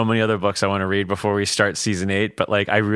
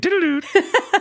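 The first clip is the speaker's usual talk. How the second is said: high giggle; 3 dB louder than usual. Real, not acted. Joking about Scooby-Doo ending.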